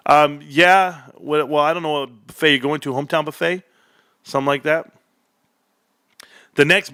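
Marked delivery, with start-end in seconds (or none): none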